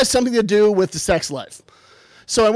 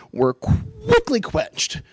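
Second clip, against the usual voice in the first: mock dramatic; much slower than usual. Dreams of being the Duke end.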